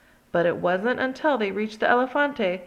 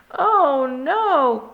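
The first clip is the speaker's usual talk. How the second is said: higher, louder cry of alarm; 5 semitones higher, 6 dB louder than usual. Acted. Scapito cries as the dog tower tumbles, alarmed. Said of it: "cried Scapito".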